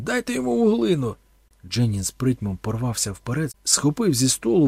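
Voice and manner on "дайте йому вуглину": much higher, louder urgent command; urgent shout. A narrator's reading. Thorn yells to give the priest charcoal. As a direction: yell the order urgently.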